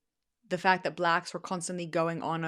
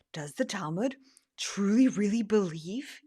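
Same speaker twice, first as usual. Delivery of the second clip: whisper. Real, not acted. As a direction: hush it as if on the phone. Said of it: "yes, I'm on my phone right now".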